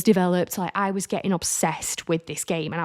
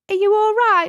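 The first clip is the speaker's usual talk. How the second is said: high, louder caring question from the nail tech; concerned friend; 5 dB louder than usual. Retold or imitated. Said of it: "She goes".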